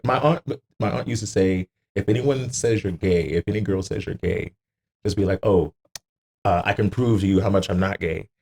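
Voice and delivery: ordinary delivery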